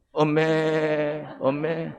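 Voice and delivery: long, level, drawn-out bleat; lamb's bleat. Shown as a demonstration. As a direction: stretch it slowly like a bleating lamb. Leads congregation in lamb's bleat.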